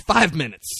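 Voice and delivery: acted cook's curt call; calling back. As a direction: holler back from the grill. Acted cook calling back to boss.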